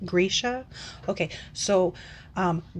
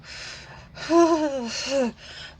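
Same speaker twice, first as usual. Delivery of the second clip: long breathy sigh; exasperated sigh; 7 semitones higher than usual. Real, not acted. Reviewer fed up with the narrator's accent, long sigh. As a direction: sigh it out, worn down.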